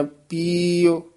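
ਪੀਓ drawn out slowly with a long ee after the p; ਪੀਓ said slowly and clearly; careful pronunciation. Shown as a demonstration. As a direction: stretch ਪੀਓ slowly, lengthening the ee. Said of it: "ਪੱਪੇ ਦੀ ਬਿਹਾਰੀ ਵਧਾ ਦੇਣੀ ਆ".

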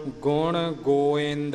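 slow ਗੋਇੰਦ as go-ind, no v or b; vowel pronunciation. Shown as a demonstration. Teacher demonstrating the reading slowly, ਗੋਇੰਦ as go-ind. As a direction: draw out ਗੋਇੰਦ with vowel meeting vowel. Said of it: "ਈੜੀ ਦੇ ਨਾਲ ਪਾਠ".

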